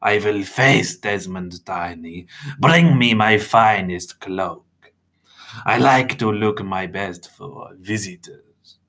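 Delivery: slow, deliberate vampire voice; proud vampire, measured and slow; formal, drawn-out vampire speech; vampire mentor. Acted.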